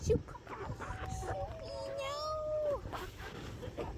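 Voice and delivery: high coo. Real, not acted. Cooing at the puppy.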